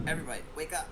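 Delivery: shouted wake-up call. Acted. Player's character rousing the party from watch, shouting. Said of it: "Amari's gonna shout".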